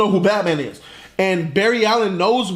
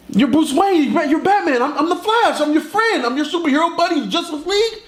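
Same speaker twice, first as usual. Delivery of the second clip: high, insistent comic explanation; urgent pleading; 9 semitones higher than usual. Acted. Flash convincing Batman they are friends, high urgency. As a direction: reel it off high, desperate to be believed.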